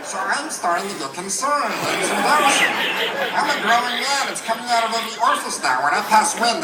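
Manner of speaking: high, squeezed helium-victim complaint; worried patient stuck on helium. Acted. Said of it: "with the helium voice".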